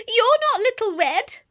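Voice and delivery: put-on high, loud alarmed old lady; alarmed granny. Granny confronts the wolf.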